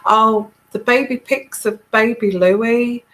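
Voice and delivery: ordinary talk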